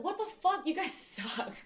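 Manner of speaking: high, shocked outburst breaking into a laugh; outraged disbelief. Retold or imitated. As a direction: react to the videos with laughing outrage. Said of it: "And I was just like".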